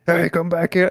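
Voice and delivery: imitating an angry man yelling, high and loud; high-pitched mock-furious shout; mad shout. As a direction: holler it like he's losing it. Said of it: "he goes fucking mad".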